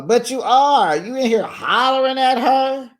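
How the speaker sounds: loud, high-pitched exasperated callout; scolding. Acted. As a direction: holler the accusation. Scolding Shamea for hollering.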